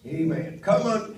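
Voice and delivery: ordinary talk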